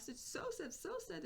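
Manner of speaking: high, hammed-up woe; melodramatic sadness. Inner child turning it into melodrama, high mock sadness. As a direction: wail it lightly, climbing in pitch. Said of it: "becoming this melodrama".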